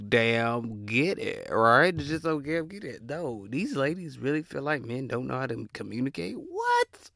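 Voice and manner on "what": a squeaky what, far higher; shocked what. Real, not acted. Hearing men can't communicate, squeaks in shock. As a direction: shoot up in disbelief. Disbelief at a claim.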